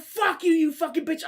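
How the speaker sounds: high-pitched angry retort; acted road-rage insult. Other driver insulting him from his car, high angry yell.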